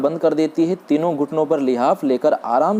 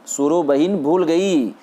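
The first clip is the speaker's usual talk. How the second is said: ordinary delivery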